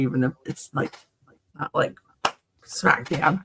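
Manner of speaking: usual speaking voice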